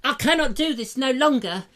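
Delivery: high, put-on lovesick voice; hammed-up Juliet. Juliet giving up on Romeo, in a high put-on whine. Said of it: "and so she said".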